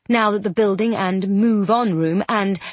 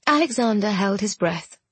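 usual speaking voice

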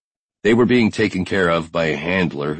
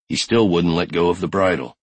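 usual speaking voice

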